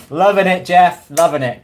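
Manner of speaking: loud, sarcastic cheer at the troll; taunting shout of thanks; loud mock praise. Real, not acted. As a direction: cheer him on with mock delight.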